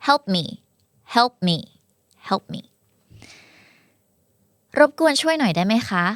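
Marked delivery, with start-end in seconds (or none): 0.1-2.6 "Help me" with unreleased p; closed-lip P. Shown as a demonstration. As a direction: shut the lips on the p of Help, then straight to me. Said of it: "P ปิดปากเลย". (Help me ปิดปากที่ p ไม่มีเสียงเพอ)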